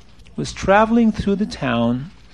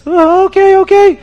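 high, loud mock wail; crying penitent; 13 semitones higher, 7 dB louder than usual. Sinner pleads with the rabbi, hammed-up crying. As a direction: wail like a desperate, guilt-ridden man. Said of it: "the man is crying and screaming".

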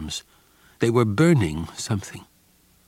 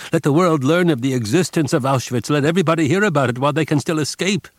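loud rallying call, faster at the end; cried out; 6 semitones higher, 4 dB louder, faster than usual. A narrator's reading. Young man urges the others to revolt, crying out. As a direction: rouse the crowd to revolt. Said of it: "One of the young men cried".

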